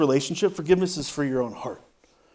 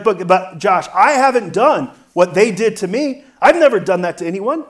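objecting listener; 4 semitones higher, 7 dB louder than usual. Retold or imitated. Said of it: "You're like".

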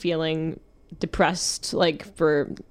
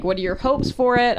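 ordinary talk, nothing marked

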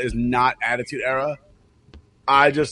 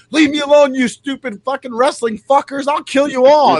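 higher, louder raging outburst; furious yell; 10 semitones higher, 7 dB louder than usual. Retold or imitated. Fed-up woman screaming threats at the prank callers. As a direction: blast the callers with fury. She's like.

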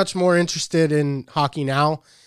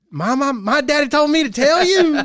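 put-on much higher, louder giggly kid voice; imitating his daughter; 13 semitones higher, 6 dB louder than usual. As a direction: squeak it out like a cheeky little girl through laughter. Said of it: "You tell your mom".

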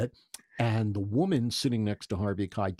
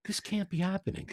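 disbelief; 7 semitones higher, 3 dB quieter, faster than usual. Retold or imitated. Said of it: "And I'm like".

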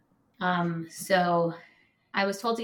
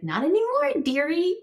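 much higher, louder sugary nurse voice; 11 semitones higher, 5 dB louder than usual. Retold or imitated. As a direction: coo it like a creepy sweet nurse. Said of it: "she was like".

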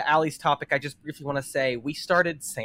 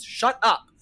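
higher, drawn-out mock scolding; retold full-voice snap; 5 semitones higher, slower than usual. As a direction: imitate a fed-up moviegoer, only mildly raised.

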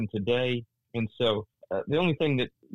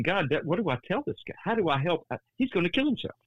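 higher, pleading cry to God, speeding up at the end; pitched-up frantic plea; desperate prayer; 7 semitones higher than usual. Retold or imitated. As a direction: plead with God in rising panic. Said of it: "I'm praying".

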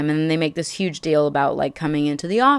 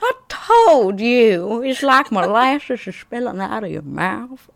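Southern plantation owner; 5 semitones higher, 6 dB louder than usual. Acted. Host mocking Rick's rebuke as molasses, hammed-up Southern accent. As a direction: drag it out in a syrupy, exaggerated Southern accent. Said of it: "like, a full-on plantation owner".

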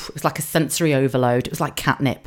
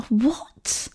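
high, soft incredulous question; hushed retold shock; 8 semitones higher, 5 dB quieter than usual. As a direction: react in mock disbelief. Retold shock at hearing of shouting.

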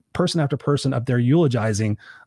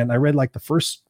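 ordinary delivery

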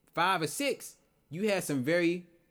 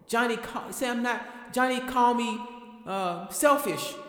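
put-on child voice; 8 semitones higher than usual.

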